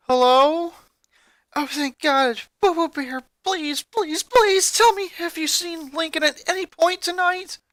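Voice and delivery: high, pleading voice near tears; desperate, near tears. Acted. Girlfriend begs boyfriend on the phone, desperate and near tears. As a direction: beg on the verge of crying. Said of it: "from her broken voice she was on the verge of crying".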